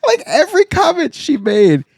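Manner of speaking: laughs through it, high and slow; giggling out the words; laughing high. Real, not acted. Friend laughing while telling about the saleswoman.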